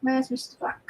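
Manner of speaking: ordinary talk, nothing marked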